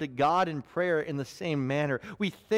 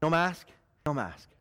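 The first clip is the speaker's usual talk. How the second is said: honest weary prayer; 4 semitones lower than usual. Retold or imitated. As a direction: let the guard down and admit fatigue. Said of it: "we can be very vulnerable".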